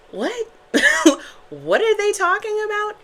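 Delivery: high-pitched, incredulous imitation with a laugh; baffled viewer. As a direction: squeak it in high, amused disbelief. Acts out a baffled tarot viewer.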